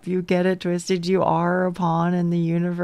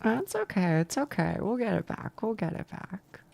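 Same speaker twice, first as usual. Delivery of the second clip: softer and softer, comforting herself; soft self-reassurance; 8 dB quieter than usual. Real, not acted. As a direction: talk yourself down gently, fading to near silence. Comforting herself after a fall.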